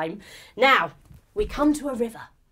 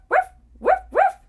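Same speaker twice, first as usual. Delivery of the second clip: high, loud playful woofs; dog barks; 8 dB louder than usual. Acted. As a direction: yap like an excited dog. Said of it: "Maybe go woof".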